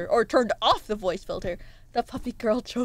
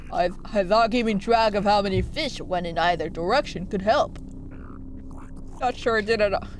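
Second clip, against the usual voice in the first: put-on low old-timer character voice; old man voice; 5 semitones lower than usual. Old fish admitting doubts to the player, old man voice. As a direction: read it as an old fish, pitched down. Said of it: "That's an old man voice".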